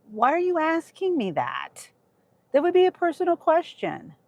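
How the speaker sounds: real irritation, voice pitched well up; short-tempered. Real, not acted. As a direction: push back testily in a high voice. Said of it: "I'm being, you know, short-tempered".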